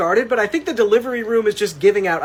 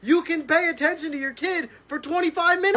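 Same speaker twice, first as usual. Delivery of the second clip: mock exasperated plea, pitched up; pleading narrator; 8 semitones higher than usual. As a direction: beg the mom to play with her kid. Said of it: "points at the iPad and is like".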